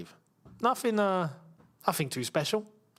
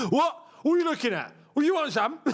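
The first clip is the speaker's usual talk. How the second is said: fighting football fan; 7 semitones higher, faster than usual. Retold or imitated. Mimics fans starting a fight.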